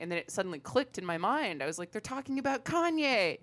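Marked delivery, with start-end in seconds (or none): 1.9-3.4 high, rising excitement; retold excited realization. Acting out when it clicked in her mind, excited realization. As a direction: blurt it out as the penny drops, much higher.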